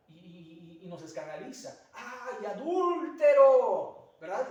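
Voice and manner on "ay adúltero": "adúltero" drawn out slow, much higher and far louder, in mock shock; mock gossip pouncing on "adúltero", loud and stretched; scandalized overstress. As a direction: gasp "Ay" then hammer "adúltero" slowly as if it were scandalous. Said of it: "le ponemos ahí un acento donde no lleva acento".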